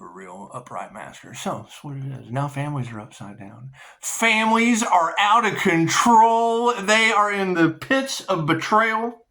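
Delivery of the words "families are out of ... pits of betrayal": emphatic declaring. Real, not acted. Emphatic rant about families.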